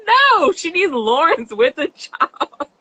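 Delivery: high, slow, emphatic objection; mock protest. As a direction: drag it out like a firm no. Voicing her own reaction.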